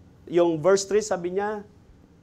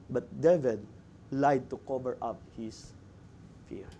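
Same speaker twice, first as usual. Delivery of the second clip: quiet verdict; 8 semitones lower, 10 dB quieter than usual. Real, not acted. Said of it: "This is the first desperate move".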